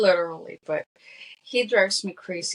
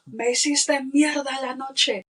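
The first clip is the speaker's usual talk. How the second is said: loud, higher-pitched Spanish outburst; upset, angry quote; 6 semitones higher, 6 dB louder than usual. Retold or imitated. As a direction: hurl it out in Spanish, imitating his fury. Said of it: "how upset Manny was at Nelly".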